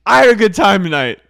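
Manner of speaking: high, loud, fast sloppy goodbye; drunk Miranda. Retold or imitated. Miranda ending the night, loud sloppy voice. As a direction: gush it out before passing out. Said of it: "She goes".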